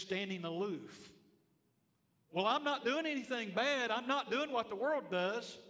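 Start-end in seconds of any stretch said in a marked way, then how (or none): 2.3-5.6 mocking complacent believer. Mock quote of complacent believer.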